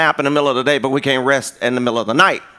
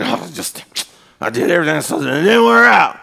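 put-on old man mode; 2 semitones higher than usual. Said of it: "old man mode".

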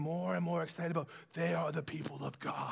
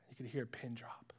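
hushed narration; 8 semitones lower, 12 dB quieter than usual. A narrator's reading.

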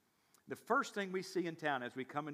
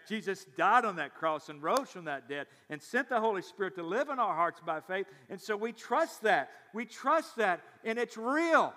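building from firm to raised, higher preaching; impassioned proclamation; 4 semitones higher, 6 dB louder than usual. Real, not acted. Pastor declaring the resurrection to congregation, building in fervour. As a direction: proclaim it with mounting force.

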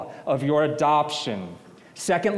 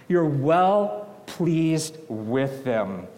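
stretched slow; much slower than usual. Acted. Preacher savoring the phrase.

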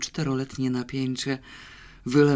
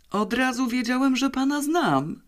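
much higher, delighted voice; joyful exclamation; 11 semitones higher than usual. A narrator's reading. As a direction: cry out happily on recognising someone. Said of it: "wykrzyknął radośnie". (radosny, wysoki okrzyk)

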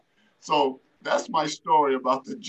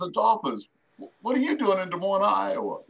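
surprised recognition; 9 semitones higher than usual. Retold or imitated. Road manager recognizes old friend.

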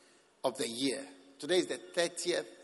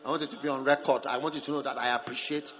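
rushed thanks, loud start dropping low; insincere thanks; 5 semitones lower, 5 dB louder, faster than usual. Acted. Grudging person putting thanks on record, rapid and ungrateful. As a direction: reel off perfunctory thanks as a formality. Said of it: "doesn't carry the message of thanksgiving".

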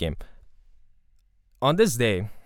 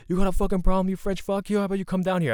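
angry fan challenge; 8 semitones higher, faster than usual. Retold or imitated. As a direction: throw the threat up at the box seats. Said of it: "it was like".

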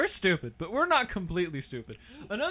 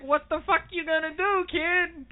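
mock tough-guy taunt, pitched up; 8 semitones higher than usual. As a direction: scoff at the kid, half shouting. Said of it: "you would just be like".

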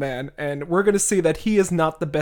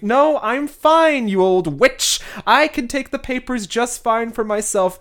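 mock catty retort; 6 semitones higher, 4 dB louder than usual. [name] snaps back at Anna.

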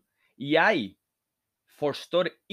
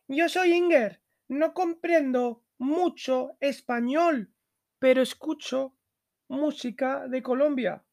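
put-on much higher voice for the girl Inger; Inger's voice; 12 semitones higher than usual. Teacher reading Inger's lines to the class, high pitched. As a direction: lift the voice high to play Inger.

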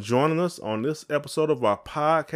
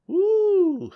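very high mock "woo" of falling; falling yelp; 5 dB louder than usual. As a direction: squeal like you're slipping off the porch. Retelling slipping on ice.

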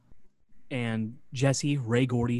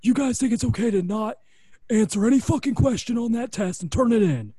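angry boss rant; 10 semitones higher, 7 dB louder than usual. Retold or imitated. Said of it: "Laurinaitis says".

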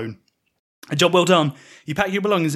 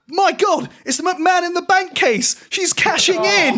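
excited commentator; 14 semitones higher, slower than usual. Acted. Commentator reacting to a shock twist.

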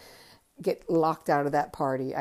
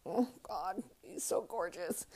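hushed, much softer gush; swooning aside; 2 semitones higher, 11 dB quieter than usual. Retold or imitated. Fan marvelling at Bowie's looks, hushed swoon. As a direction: breathe it softly to yourself in awe.

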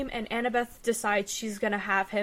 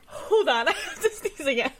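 laughing, pitch climbing high; 6 semitones higher, 3 dB louder than usual. Real, not acted. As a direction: giggle through it, rising. Laughing, about to sneeze.

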